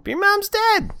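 very high, squeaky wife voice; incredulous wife. Retold or imitated. Retelling the wife catching her husband's lie, incredulous.

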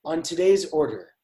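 host intro over a coffee order; Michael Barbaro impression. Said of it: "he's like".

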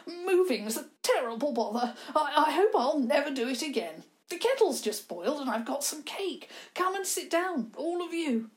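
fluttery host offering tea; eccentric old man. Acted.

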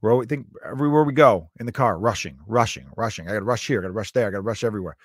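normal talking voice

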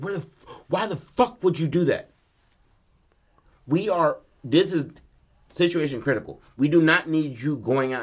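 high, exasperated acted scolding; a furious dad chewing out his son; losing it. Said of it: "his dad loses his shit on him".

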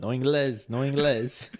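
joking put-on Mexican accent; mock Mexican accent, close to laughing. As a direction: play the Spanish-only caller in a mock Mexican accent. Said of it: "answer with a hella Mexican accent".

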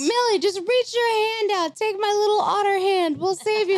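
high, tiny heroic otter voice; squeaky otter voice. Pretend. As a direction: coax her out like a little otter. Otters voiced rescuing Millie.

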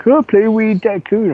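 high, drawn-out mocking plea; mock pushy invite. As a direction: mimic an annoying game invite in a high voice. Mimicking pushy Facebook game invites.

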